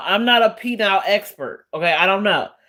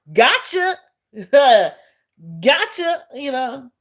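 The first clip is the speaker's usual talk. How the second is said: teasing gotcha; 5 semitones higher than usual. Real, not acted. Teasing the commenter.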